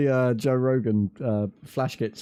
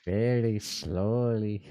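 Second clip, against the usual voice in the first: slow drawn-out; 3 semitones lower, 5 dB quieter, much slower than usual. Acted. Drawn-out remark to friend.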